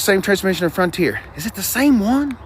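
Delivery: ordinary delivery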